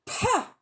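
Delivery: high, contemptuous snort of pah; scornful pah. A narrator's reading. Diggory scoffing at Uncle Andrew, contemptuous pah. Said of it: "thought Diggory to himself".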